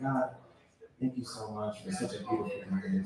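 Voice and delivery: soft, low murmured prayer; hushed prayer. Retold or imitated. Driver praying to God in traffic, hushed prayer. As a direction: pray quietly and reverently. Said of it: "pray, but, you know, the traffic is".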